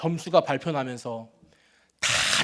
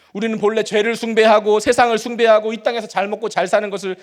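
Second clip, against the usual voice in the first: louder driving preaching; preaching at full force; 4 semitones higher, 8 dB louder than usual. Acted. Pastor warning the congregation, forceful preaching. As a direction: hammer the point home to the congregation.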